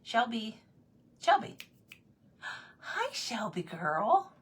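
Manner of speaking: high pet-calling voice; little pet voice. Real, not acted. Owner calling Shelby the cat, little pet voice. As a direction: coo up high to an animal, drawing it out at the end. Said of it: "use your little voices when you talk to your animals".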